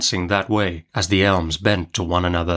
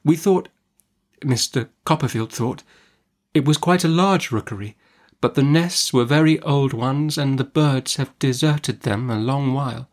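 put-on higher, hesitant young widow's voice; timid mother; 4 semitones higher than usual. A narrator's reading. Mother explains the empty nests to the aunt, hesitant. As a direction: explain haltingly, defending yourself.

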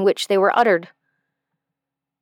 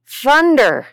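cry out; 4 semitones higher, 3 dB louder than usual. Acted. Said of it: "he cried".

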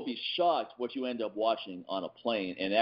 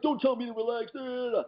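high mock scolding; imitating angry lady; 13 semitones higher than usual. Lady snapping at the dog walker, mock high. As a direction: snap back in a high squeal. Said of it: "She's like".

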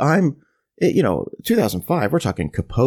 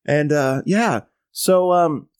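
ordinary delivery

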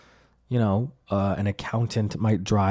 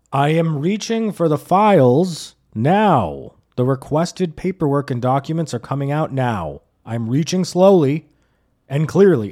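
louder, slow and formal like a report; robotic compliance; 4 semitones higher, 4 dB louder than usual. Retold or imitated. Driver narrating his moves to cops, mock-formal announcing. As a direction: announce each move deliberately.

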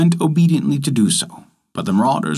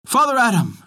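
calls Father Adam high, pitch leaping up; an eager cry for attention; 9 semitones higher than usual. A narrator's reading. Cat calling out to Father Adam, high eager cry. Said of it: "she cried".

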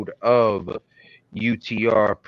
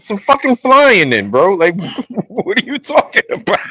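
exasperated; 10 semitones higher, 7 dB louder than usual. Retold or imitated. Exasperated retold retort.

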